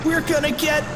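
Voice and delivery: high-pitched halting wail of doom; panicked cry. Acted. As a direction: squeal it, stumbling for the word in panic. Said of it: "cried Fluffy".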